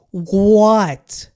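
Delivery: a loud, stunned what; outraged shout. Real, not acted. Disbelief at ranking.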